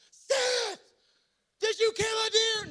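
high, loud call across the field; stepdad's walkie-talkie call. Retold or imitated. Stepdad calls out to Seth over the walkie-talkie, high loud hail. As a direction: shout it into the walkie-talkie from far away. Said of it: "He gets on the walkie-talkie".

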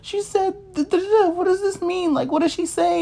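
high, pleading, near-tears voice; panicked crying call. Retold or imitated. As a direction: plead on the phone in a frantic, high voice. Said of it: "I used to call them crying".

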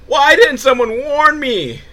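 high, loud, slow wail of regret; hammed-up lament at his own idea; mock despair.

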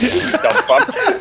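slow, high sneering jab; mock insult. Ex-lineman mocking his doubters with a slow, high sneer. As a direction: spit it out like a scornful jeer. Mocking the team's scornful view.

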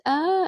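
high crying wail; mimicking daughter's crying ah; imitated wail. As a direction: let out a child's wail. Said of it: "and then just crying".

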